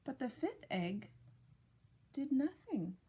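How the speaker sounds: ordinary delivery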